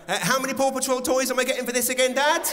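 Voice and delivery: put-on fast, high, loud kid bargaining; quick high mock child voice; cheeky kid. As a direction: haggle like a spoilt child. Imitating son bargaining.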